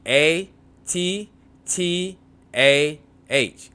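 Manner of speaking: "A T T A H" spelled slowly, letter by letter. Shown as a demonstration. Teacher spelling "A T T A H" slowly for students. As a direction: spell "A T T A H" out one letter at a time. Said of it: "Note the double T".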